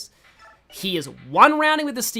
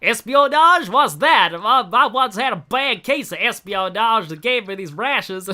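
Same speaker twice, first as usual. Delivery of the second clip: loud, puzzled southern hillbilly; Brom's southern accent; 2 semitones higher, 5 dB louder than usual. Acted. Clueless knight answering talk of espionage, southern accent. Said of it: "Brom's also the guy who has that line".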